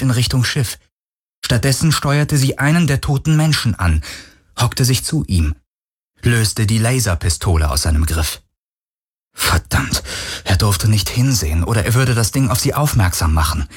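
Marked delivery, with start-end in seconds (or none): none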